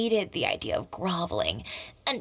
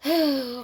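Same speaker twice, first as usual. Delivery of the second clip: a loud, weary sigh out; heavy sigh; 5 semitones higher, 5 dB louder than usual. Acted. Reluctantly accepting Charlotte joined the fight, a heavy sigh. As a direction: let out an exasperated sigh. Frustrated sigh before agreeing.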